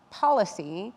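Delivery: ordinary talk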